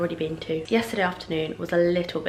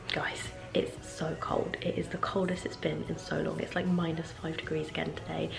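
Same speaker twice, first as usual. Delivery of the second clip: whispering; 2 semitones lower, 9 dB quieter than usual. Real, not acted. Whispered morning chat.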